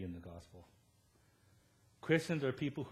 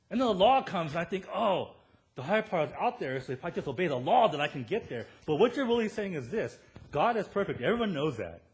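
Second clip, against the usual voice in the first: louder, higher, pounding preacher voice; preaching at full force; 6 semitones higher, 7 dB louder than usual. Acted. Pastor pressing the MRI point to his church, full-force preaching. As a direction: hammer the point home to the congregation.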